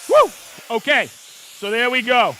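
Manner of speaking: loud, high, thrilled calling out; buzzing with excitement, can't hold it in; real excited shout. Real, not acted. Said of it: "I got all excited there".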